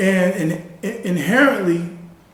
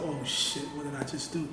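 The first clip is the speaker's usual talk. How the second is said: much softer retold inner thought; muttering to himself; 4 semitones lower, 15 dB quieter than usual. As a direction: drop to a hushed aside, as if only for yourself. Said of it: "I'm saying to myself".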